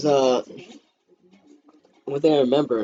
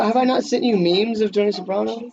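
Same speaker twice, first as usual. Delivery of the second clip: mock indignant, higher; 9 semitones higher than usual. Mock outrage at question.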